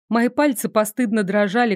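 ordinary delivery, nothing marked

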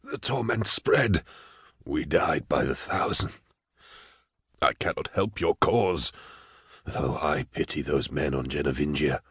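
very soft, slow, haunted voice; haunted terror. A narrator's reading. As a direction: recount the horror in a hushed voice. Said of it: "there was terror in his voice".

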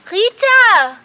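loud call across the lawn. Acted. Friend calls Rita over for camera help, loud call. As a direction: shout her name to fetch her.

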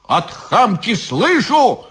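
high, drawn-out retort without full volume; yelling back. A narrator's reading. Bound Ivan answers the witch, yelling back. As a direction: throw the insult back high. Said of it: "тоже заорал Иван".

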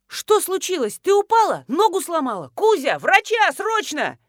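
high, urgent cries for help; alarmed. A narrator's reading. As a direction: burst in firing panicked questions. Сеня вбегает, зовёт врача в панике.

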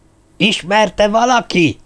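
high, drawn-out forceful question; stern demand. A narrator's reading. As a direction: demand an answer from the whole group. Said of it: "ismét az asztalra vert".

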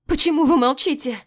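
high, loud desperate plea; panicked. A narrator's reading. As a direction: demand an answer in panic. Panic at silent women.

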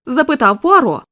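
plain reading voice

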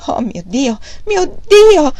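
acted anguished outburst; tearful exclamation. A narrator's reading. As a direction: wail rising to a high, loud cry. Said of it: "esclamò Fanny piangendo".

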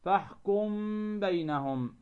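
فاحكم بينهم with a held hum on the m; ikhfa with ghunna. Shown as a demonstration. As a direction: linger on the m of فاحكم, then release into بينهم. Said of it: "هنالك غنة". (إخفاء ميم فاحكم عند الباء مع الغنة)

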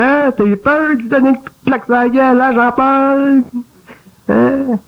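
high taunting voice, slowing on the name at the end; mocking little voice. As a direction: needle him like a sly bird pecking. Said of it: "elle dit".

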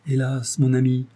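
usual speaking voice

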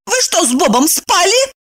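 high-pitched strict interrogation; stern. A narrator's reading. Evdokia interrogates her friend about Bob, sternly. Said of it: "строго спросила она".

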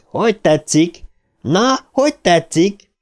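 high-pitched, jeering questions; taunting yell. A narrator's reading. Mike jeers as stones hit the gang, high taunting.

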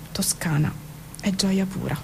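normal talking voice

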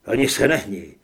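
low, loud warning; stern threat. A narrator's reading. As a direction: order them to freeze at gunpoint. Narrator threatens Grant at gunpoint. (výhružný rozkaz)

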